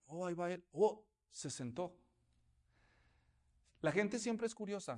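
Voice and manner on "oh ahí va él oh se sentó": gossiping onlooker. Retold or imitated.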